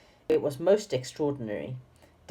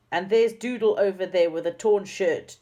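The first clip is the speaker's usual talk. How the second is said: higher and louder, cross; scolding; 5 semitones higher, 5 dB louder than usual. A narrator's reading. Dame Snap spotting Doodle's torn shirt, cross. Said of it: "said Dame Snap".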